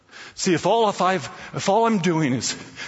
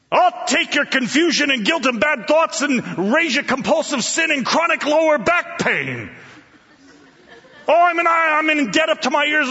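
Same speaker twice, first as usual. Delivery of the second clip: higher, brash challenge from the back; one-upping heckler calling across the room; mock heckling yell; 6 semitones higher than usual. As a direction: top his confession like a cocky rival. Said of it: "someone yells".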